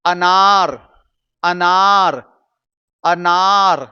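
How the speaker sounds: अनार said loudly with the second vowel stretched; say-along model. Shown as a demonstration. Teacher leading students to say it along, drawn-out word. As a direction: lead the repetition of अनार, slow and emphatic. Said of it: "इसे बोलिएगा मेरे साथ".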